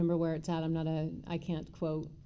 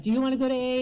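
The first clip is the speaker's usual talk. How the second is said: teasing question; 6 semitones higher, 7 dB louder, much faster than usual. Retold or imitated. Teasing game with husband.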